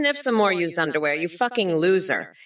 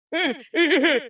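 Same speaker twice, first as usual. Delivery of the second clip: high laugh; 6 semitones higher than usual. Acted. Odd vocal break mid rant.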